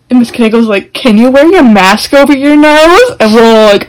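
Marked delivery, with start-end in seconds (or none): 0.9-3.2 higher, louder, slow mock scolding; teacher scolding. Imitating the teacher telling a girl to fix her mask, loud. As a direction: bark the order like a fed-up teacher.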